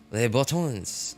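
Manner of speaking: drawn-out attempt at a French accent. Shown as a demonstration. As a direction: say "boutons" slowly with a French accent. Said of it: "said in a French accent".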